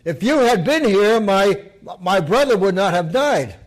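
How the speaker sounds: loud, high, grieving reproach; reproachful sister. Acted. Grieving sister blames Jesus for her brother, loud high reproach. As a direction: cry out the blame.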